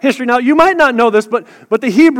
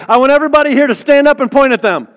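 ordinary talk, nothing marked

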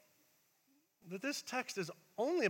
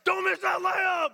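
high, slow, full-force shout; imitated coach's bellow; yelling coach; 13 semitones higher, 12 dB louder, slower than usual. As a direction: yell each word at the player. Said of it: "like yelling".

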